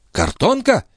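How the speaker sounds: high-pitched startled query; astonished repeat of the news; incredulous echo question. A narrator's reading. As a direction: ask it back in disbelief. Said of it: "переспросила графиня".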